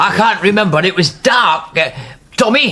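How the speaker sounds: frightened he can't remember, calling for help; upset, confused. Acted. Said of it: "don't upset yourself".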